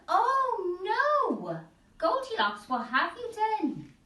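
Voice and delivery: high, shocked cry; alarmed, as if caught in the act; shocked gasp. Acted. Shocked voice in story.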